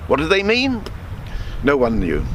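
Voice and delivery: plain reading voice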